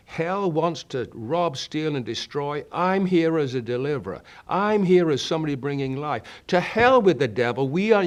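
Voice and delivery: rally the church against hell, building to a roar; preaching at full force. Acted. Preacher to congregation, declaring war on the devil, fiery shouting.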